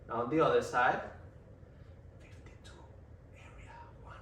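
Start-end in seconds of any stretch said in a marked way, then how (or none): 2.2-4.2 breathy whispered test words right in the ear. Shown as a demonstration. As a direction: whisper it low beside the ear. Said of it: "I'm gonna whisper on the other ear".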